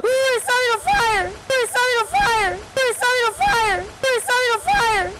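cracked, squeaky high yelps; voice cracking up an octave. Real, not acted. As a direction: voice breaking way up high. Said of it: "My voice cracked".

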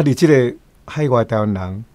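ordinary talk, nothing marked

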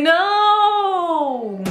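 put-on high-pitched little child's protest; mock baby no. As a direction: squeal it like an upset toddler. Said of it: "She's like".

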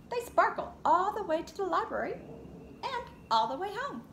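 lively read-aloud picture book voice; reading to children. Acted. Teacher reads Lola Loves Stories to her class, in a lively storybook voice. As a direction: read it to a circle of little kids.

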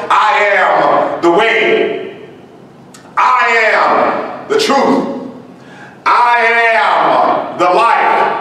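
slow, measured, each I rising; slow proclamation. Acted. Preacher reading Jesus' words to the flock, slow and solemn. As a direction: spell out the three claims one by one.

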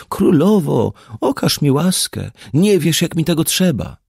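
plaintive beggar. Acted. Disguised Tristan begs the queen for mercy, high and plaintive. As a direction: beg piteously for alms, voice disguised. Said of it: "błaga żałośliwym głosem".

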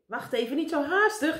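high, urgent call. A narrator's reading. Meneer Pen calls Karel back, calling out. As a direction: call after someone leaving too soon. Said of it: "riep meneer Pen".

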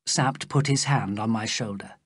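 plain reading voice

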